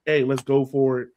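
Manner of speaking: usual speaking voice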